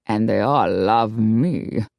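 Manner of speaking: serenely pleased with himself; calm, smug. A narrator's reading. Don Orlando excusing himself to both women, slow and smug. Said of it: "explained calmly".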